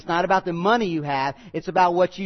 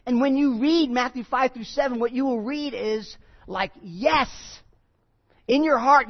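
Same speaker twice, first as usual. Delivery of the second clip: raised, emphatic preaching voice; 5 semitones higher than usual. Acted. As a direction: lift it up like calling a congregation to agree. Preacher voicing heart's yes.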